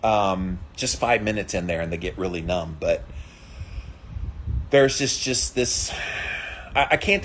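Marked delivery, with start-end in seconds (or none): none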